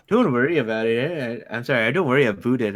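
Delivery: a light, friendly put-on Canadian accent; a hint of a Canadian accent, still going. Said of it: "real Canadian accent".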